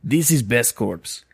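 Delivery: slow Russian accent. Shown as a demonstration. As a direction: say it like a Russian dealer. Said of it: "That's the most Russian I can do".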